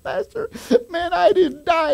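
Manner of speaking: very high, tearful-sounding imitation; high-pitched acted amazement; overjoyed survivor. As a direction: act out astonished relief in a high voice. Voices survivor telling his miracle.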